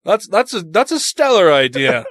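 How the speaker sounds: posh South African voice; South African accent. Acted. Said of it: "That was South African".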